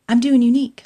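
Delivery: a loud happy declaration; proud announcement. Real, not acted. Proud reveal of the reason.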